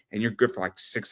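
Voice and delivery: usual speaking voice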